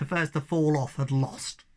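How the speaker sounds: ordinary delivery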